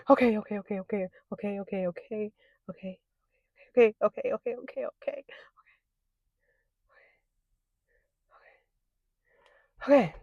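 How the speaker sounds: soft murmured repeats; hushed calming chant; quiet self-soothing. Real, not acted. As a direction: talk yourself down under your breath. Calming herself before the bite.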